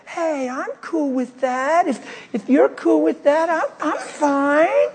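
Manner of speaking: put-on the tipsy girl agreeing, slow and high. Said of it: "the girl's like".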